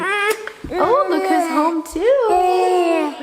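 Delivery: drawn-out happy hello; welcoming someone home. Real, not acted. Greeting family arriving home.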